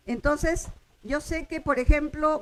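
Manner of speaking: ordinary talk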